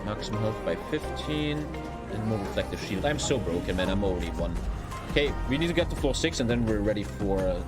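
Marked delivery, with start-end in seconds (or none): none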